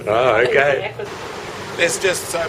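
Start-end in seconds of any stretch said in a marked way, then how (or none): none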